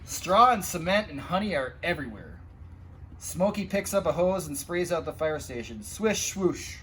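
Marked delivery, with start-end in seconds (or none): none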